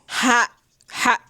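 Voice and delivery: half laugh, half cry at the ending; a strangled laughing sob; pained laugh. Real, not acted. Reacting to cliffhanger ending.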